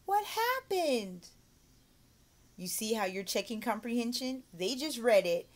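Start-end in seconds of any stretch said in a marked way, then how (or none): 0.0-1.3 put-on high, slow, wide-eyed question to a child; astonished question. Shown as a demonstration. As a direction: ask as if shocked by the story. Demo comprehension question.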